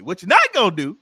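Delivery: high and loud, stern and animated; animated warning. Shown as a demonstration. Comedian showing how a mama really says it, animated. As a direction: warn them like a mama who caught you. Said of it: "It's like it's animated".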